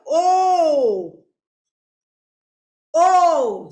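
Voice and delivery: loud, long-held "ઓ" as a pure o vowel; sound demonstration. Shown as a demonstration. Teacher shows children the vowel's sound, long, loud "ઓ". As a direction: stretch "ઓ" out loudly. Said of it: "એનો ઉચ્ચાર શું થાય".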